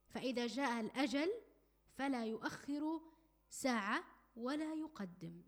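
slow, emphatic formal Arabic; measured, weighty pronouncement; solemn sermon delivery. Real, not acted. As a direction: draw out each phrase like a preacher delivering the moral. Draws the moral in formal Arabic.

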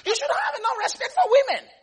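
high, loud, half-laughing outrage; mock-scandalized outburst; indignant protest. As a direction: protest loudly on the disciples' behalf. Voicing indignant disciples, protesting.

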